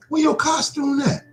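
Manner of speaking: high, put-on questioning voice; high-pitched Halloween heckler; nosy questioner. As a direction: pipe up with a challenge at the door. Same questioner challenges his costume.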